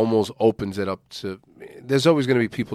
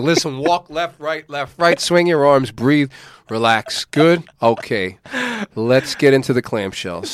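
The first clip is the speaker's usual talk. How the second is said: loud clipped drill commands; stiff barking toy soldier; 5 semitones higher, 6 dB louder than usual. Retold or imitated. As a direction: bark orders like a drill sergeant. Mocking a rushed therapy session.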